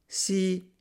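high voice of a sighing wife; wistful, high-pitched assent; sospiro di donna. A narrator's reading. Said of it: "rispose la donna sospirando". (sospirando, con voce acuta)

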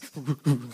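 mock sobbing noise; short burst of pretend sobs; imitated crying. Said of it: "así llorando".

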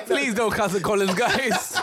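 high laughing mock plea. Real, not acted.

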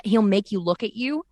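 ordinary talk

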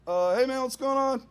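high, loud, nervous friendliness; fake-casual greeting. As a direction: greet too brightly to hide fear.

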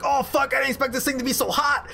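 high, fast yelp of surprise; Teb yelp. Acted. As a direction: shout it up high as Teb feels the heat. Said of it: "He goes like".

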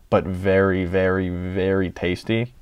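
normal talking voice

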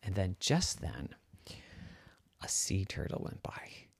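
hushed whisper; storyteller whispering to kids; whispered suspense. A narrator's reading. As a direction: whisper it like a secret reveal. Dramatic story moment.

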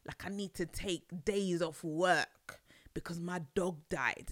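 usual speaking voice

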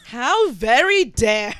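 slow, loud, high mock indignation; hammed-up offended gasp; mock outrage. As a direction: drag it out in fake shock.

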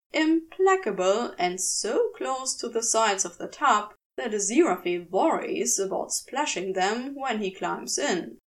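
ordinary delivery

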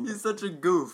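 usual speaking voice

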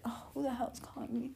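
muttered aside. Real, not acted. Interrupted by a phone call, quick soft annoyed aside.

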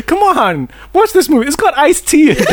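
high-pitched coaxing plug; mock sales pitch. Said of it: "That's, that's your pitch?".

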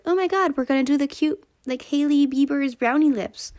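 very high, loud squealing mock fan; excited fan. Mocking fans copying Hailey's trend, high excited squeal. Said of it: "then people were like".

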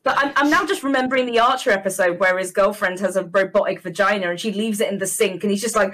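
normal talking voice

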